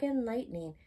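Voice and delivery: ordinary delivery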